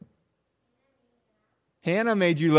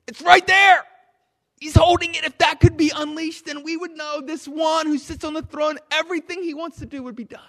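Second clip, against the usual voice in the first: much higher, louder cry of longing; preaching shout; 10 semitones higher, 4 dB louder than usual. Acted. Preacher describing the sealed scroll to the church, shouting. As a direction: proclaim it as if the plan is within reach.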